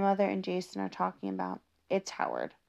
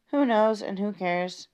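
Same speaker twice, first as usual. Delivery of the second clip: usual speaking voice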